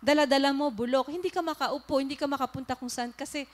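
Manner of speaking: preaching at full force. Acted. Preacher to the congregation about carrying grudges, loud fervent preaching. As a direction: hammer the lesson to the congregation. Preaches the rotten potato lesson.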